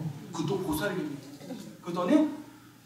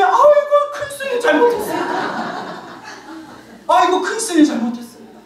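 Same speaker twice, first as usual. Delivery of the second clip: high, wailing plea with a laugh; begging old lady; 6 semitones higher than usual. Retold or imitated. Grandma pleads with the monk, high and whining. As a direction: beg forgiveness in a high old woman's voice.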